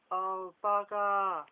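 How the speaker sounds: loud, drawn-out dismayed exclamation; surprised cry. Acted. Richard reacting to James's spell, surprised loud cry. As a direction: blurt it out in shock. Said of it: "cries Richard with a surprised look".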